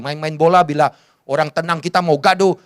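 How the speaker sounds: ordinary talk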